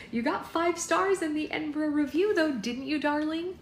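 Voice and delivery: high, eager boasting; doting girlfriend. A narrator's reading. Poppy showing off Alex to parents, high and doting. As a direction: gush high over your darling.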